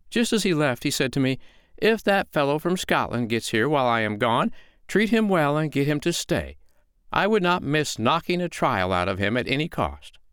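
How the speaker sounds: storybook woman, sweetly apologetic; unruffled foster mother. Acted. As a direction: explain politely, pitched well up. Foster mother explains Finn's absence.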